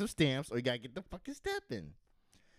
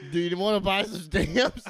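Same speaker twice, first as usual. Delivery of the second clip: laughing; 7 semitones higher, 9 dB louder than usual. Retold or imitated. Clerk voice, laughing.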